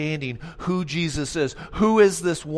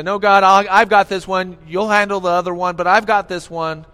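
loud, brushing God off; a believer waving off help, loud and cocky; overconfident; 8 dB louder than usual. Retold or imitated. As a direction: insist you've got this one. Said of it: "we say".

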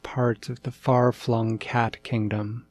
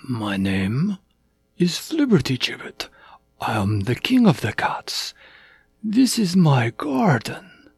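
deliberate voice swooping from usual pitch to high; grand introduction, rising into high questions; stately, kindly cat king; 7 semitones higher than usual. A narrator's reading. Cat King introduces himself to lost puppy, stately and curious.

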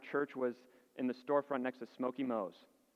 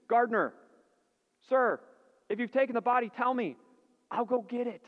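frantic plea; 9 semitones higher, 7 dB louder than usual. Acted. Mary pleading with gardener.

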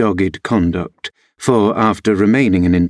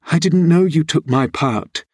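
put-on higher, plaintive girl's voice; softened by recent crying, acted; tearful; 7 semitones higher than usual. A narrator's reading. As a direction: confess it tearfully. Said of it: "drying her eyes".

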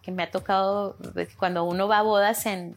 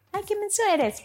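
high, fond teasing of a friend; playful, affectionate scolding; 13 semitones higher than usual. Retold or imitated. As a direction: tease them sweetly, like a little pet name. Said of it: "como un cariñillo".

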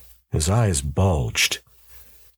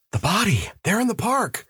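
high, urgent alarm; distraught alarm; 14 semitones higher than usual. Acted. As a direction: point out something dreadful, pitch jumping up. Shocked man pointing out corpse.

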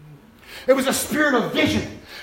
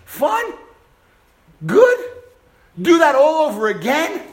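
incredulous; 3 semitones higher, 4 dB louder than usual. Real, not acted. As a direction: scoff at the idea. Incredulous echo of own words.

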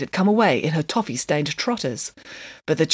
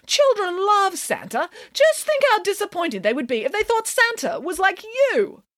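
scolding; 14 semitones higher than usual. A narrator's reading. Nanny shaming Mister Green over children's love, scolding. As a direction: shame the listener in a lofty high voice. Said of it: "scolded Nanny Piggins".

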